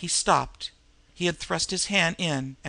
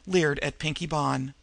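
plain reading voice

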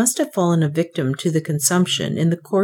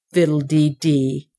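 rough dismissal; 2 semitones lower than usual. A narrator's reading. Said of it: "said Northmour roughly".

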